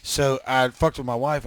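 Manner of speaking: ordinary talk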